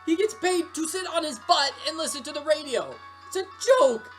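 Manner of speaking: high, heated tirade; angry rant. Acted. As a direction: rage at the unfairness of it. Rant about unfair firing.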